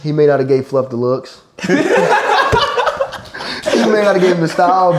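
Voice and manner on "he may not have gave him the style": talking through laughter, slower; cracking up mid-sentence; laughing at his friend's outfit. Real, not acted. Laughing while roasting Fluff.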